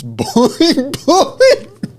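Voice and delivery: high bouncy boing sound effect; cartoon boing. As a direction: pitch it way up like a spring. Joking bouncing breasts sound effect.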